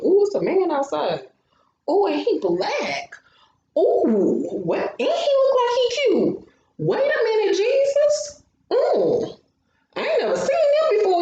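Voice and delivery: squealing high over the man outside; excited gushing. Real, not acted. Spotting a cute man outside the window, high excited gush.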